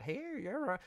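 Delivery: lightly mock a country bumpkin. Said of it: "very country bumpkin".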